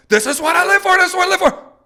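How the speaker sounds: very high, loud, frenzied dog shout; fired-up dog. Acted. Dog teased into a frenzy before the shot, fired-up shouting. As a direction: play a bird dog bursting with excitement. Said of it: "get him all fired up".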